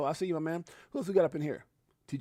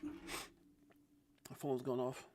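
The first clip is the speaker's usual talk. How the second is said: hushed aside; 4 semitones lower, 17 dB quieter, slower than usual. Real, not acted. Phone goes off mid-stream.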